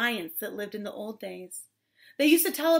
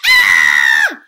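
full-volume scream; loud scream; 17 dB louder than usual. Acted. Women seeing the giant, loud scream. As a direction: scream out in mock terror. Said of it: "Women screamed".

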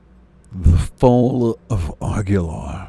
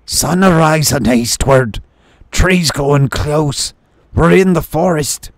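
gruff shrew voice; 7 semitones higher, 4 dB louder than usual. Acted. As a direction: call out like a gruff lookout. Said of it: "The gruff voice of a shrew".

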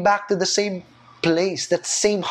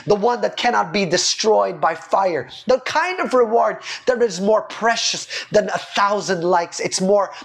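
normal talking voice

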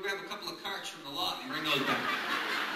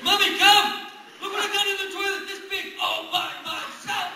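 put-on high, loud child shout; proud toddler yelling for mommy; excited little kid; 11 semitones higher, 11 dB louder than usual.

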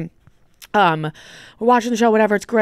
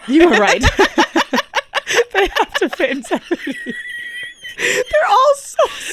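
high, squealing laughter through words; real laughing fit; 12 semitones higher than usual. Real, not acted. Guest agreeing with cohost's TV joke, helplessly laughing. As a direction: crack up while speaking.

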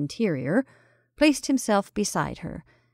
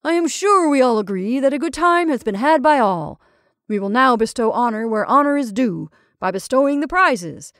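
jovial announcement; 3 semitones higher, 6 dB louder than usual. A narrator's reading. Ringmaster opens the awarding of prizes, jovial announcement. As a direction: proclaim it jovially to the whole crowd. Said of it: "announced the ringmaster jovially".

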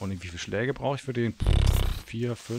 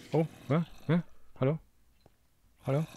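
normal talking voice

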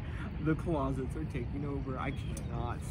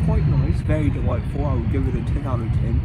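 food critic voice; 10 dB louder than usual. Acted. Food critic review, loud.

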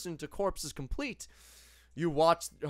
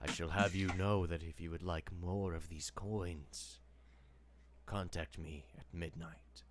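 whispering Death; 9 semitones lower than usual. Acted. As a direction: speak low and hushed as Death. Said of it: "He whispers to you".

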